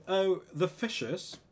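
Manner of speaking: hammed-up British exclamation; mock British accent. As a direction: play up a British accent, drawn out. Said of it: "en Inglaterra puedes decir".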